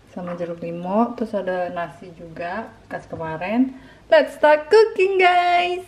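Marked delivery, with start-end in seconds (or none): 4.1-5.8 excited. Real, not acted. Excited cooking kickoff to viewers.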